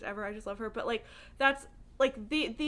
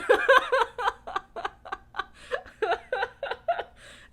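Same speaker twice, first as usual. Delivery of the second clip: long high laugh; 8 dB louder than usual. Real, not acted. Laughing at her impossible goal.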